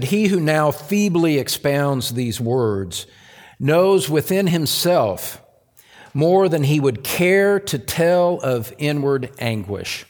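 slow, weighty reading of Spurgeon's words; solemn quoted reading. Acted. Pastor quoting Spurgeon to the church, slow and solemn. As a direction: lean into the quote slowly. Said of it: "he said".

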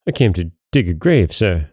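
ordinary delivery

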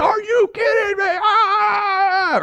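very high, disbelieving cry of joy; ecstatic fan. Retold or imitated. A fan reacting to a last-second home run, with an ecstatic high cry. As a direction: shriek in delight at the home run. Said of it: "You're like".

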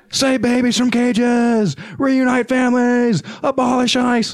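high, slow chant-like protest shout; retold yell. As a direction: call out the slogans like at a protest. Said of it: "I just yelled out".

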